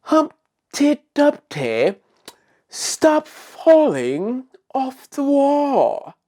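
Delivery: high, slow, put-on sophistication; sophisticated voice. Shown as a demonstration. Host showing children how to correct Humpty, sophisticated voice. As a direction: draw it out like a very refined person. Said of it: "in a sophisticated way".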